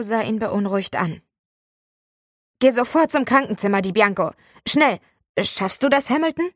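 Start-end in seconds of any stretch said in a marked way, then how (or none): 2.6-6.5 loud, urgent orders, rising high on the name; urgent teacher. Acted. Teacher urgently sends the boy to the nurse, loud. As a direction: hurry the pupils out.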